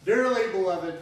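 ordinary talk, nothing marked

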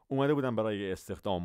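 ordinary talk, nothing marked

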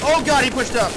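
sudden loud alarmed yell; startled shout. Real, not acted. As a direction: blurt it out in shock. Enemy pushes, startled shout.

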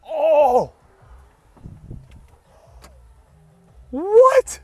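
sudden high loud surprised yelp; shocked. Real, not acted. Roof suddenly opens, shocked.

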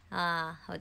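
ordinary delivery

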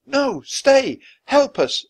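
put-on very high, loud child's plea; shouted plea. A narrator's reading. Annie begging the serpent to stay, shouting. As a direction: shout for help urgently. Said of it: "shouted Annie".

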